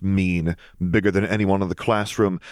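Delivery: usual speaking voice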